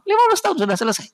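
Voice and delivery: high, fast, emphatic burst; urgent warning. Acted. Preacher warning parents time runs out, fast high burst. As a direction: fire it off urgently.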